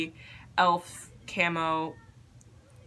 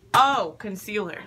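loud slow oh of discovery; sudden realization; 8 dB louder than usual. Real, not acted. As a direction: remember it all at once. Suddenly remembers product.

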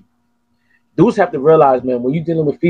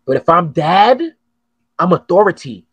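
normal talking voice

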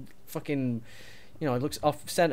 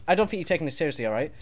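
normal talking voice

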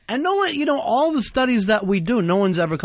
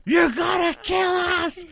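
imitated screaming; 12 semitones higher than usual. Crying girl screaming at the driver on the sidewalk, mock hysterical scream. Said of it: "who's crying and screaming".

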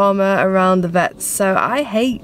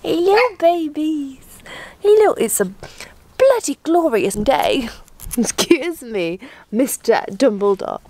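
high sing-song greeting; 5 semitones higher than usual. Real, not acted. Owner greets her dogs in the morning, high sing-song. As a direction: coo hello to the dogs.

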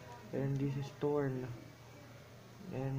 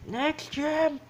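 high, loud shout of next champ; excited announcement; 13 semitones higher, 9 dB louder than usual. Real, not acted. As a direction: call it out like a game show host.

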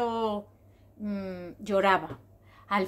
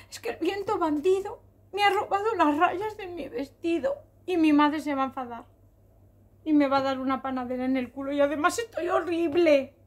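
hammed-up weepy zebra, getting louder; tearful complaint; 3 semitones higher, 3 dB louder than usual. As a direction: wail out the woes like an upset child. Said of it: "cuando estaba llorando".

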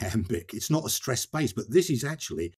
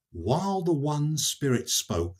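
slow, raised opening to a verse; poetry reading; 5 semitones higher, slower than usual. Acted. Reading Dante's last four lines in English, poetry reading. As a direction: read it out slowly as solemn verse.

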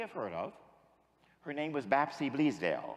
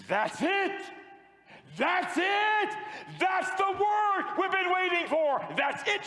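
high, loud cry of recognition; ecstatic shout; 13 semitones higher, 3 dB louder, slower than usual. Retold or imitated. Prophet hearing the awaited word, ecstatic shout.

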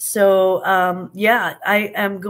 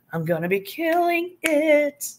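slow, high, sassy boast; fierce; 7 semitones higher than usual. Acted. Vlogger showing off her cruise jumpsuit, mock-fierce and sassy. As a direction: strut it out, a little fierce. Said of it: "I had to go a little fierce".

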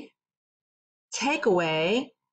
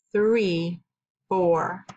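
usual speaking voice

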